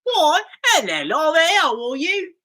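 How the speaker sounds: high, chirpy mock British greeting; hammed-up British lilt; put-on British accent. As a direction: play a British charmer, voice lifted.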